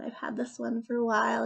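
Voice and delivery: usual speaking voice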